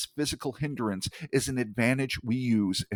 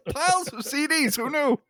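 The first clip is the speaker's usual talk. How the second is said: much higher amused exclamation; mock amazed. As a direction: play up the silly discovery. Joke about CDs.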